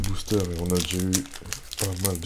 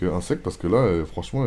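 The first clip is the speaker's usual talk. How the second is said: ordinary talk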